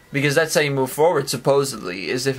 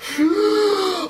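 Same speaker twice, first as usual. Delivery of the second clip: high dramatic gasp. Acted. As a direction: suck in air in mock panic. Acting out overwhelm.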